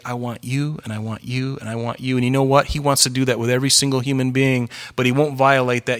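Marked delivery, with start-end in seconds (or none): 0.0-2.2 a hint of God tenderly calling each person. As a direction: speak it gently, like a call to each one. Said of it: "he would say".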